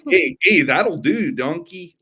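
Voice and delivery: high, loud mock-Canadian voice; jokey, half-managed Canadian accent; attempted Canadian accent. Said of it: "I can't even do, like, a Canadian".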